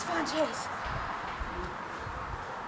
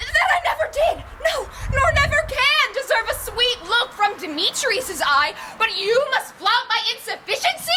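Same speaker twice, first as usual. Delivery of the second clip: tearful outburst; 6 semitones higher than usual. Acted. Helena thinking Lysander mocks her, tearful high outburst. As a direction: break from bitter laugh into tears. Helena feels mocked, bursts out.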